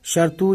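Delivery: "شرطو" with an added u at the end, shartu; wrong pronunciation. Shown as a demonstration. Teacher warning learners off a mistake, "شرطو" said shartu. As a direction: tack a u onto the t in "شرطو". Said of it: "ایسے کچھ نہیں کہنا ہے".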